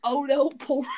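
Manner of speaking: loud laughing outburst; laughing shout. Real, not acted. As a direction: burst out laughing loudly. Laughs at unknown name.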